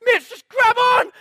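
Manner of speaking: very high, full-force drawn-out shout; panicked yell. Retold or imitated. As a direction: yell desperately to a workmate as if it's getting away. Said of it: "And I'm freaking out".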